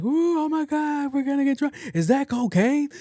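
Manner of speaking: loud, very high-pitched revelry; mock party cheering. Friends teasing mom over the phone, mock wild cheering. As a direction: shriek like rowdy friends in the background. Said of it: "is us cheering".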